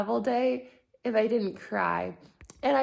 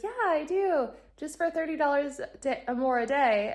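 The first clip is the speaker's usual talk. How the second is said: chirpy clerk; 6 semitones higher than usual. Retold or imitated.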